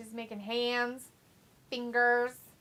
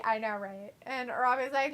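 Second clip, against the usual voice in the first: normal talking voice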